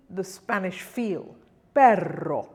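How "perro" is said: perro with a long, savored trill; louder, relish the double R in Perro; heavily rolled R. Shown as a demonstration. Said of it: "and really savor that".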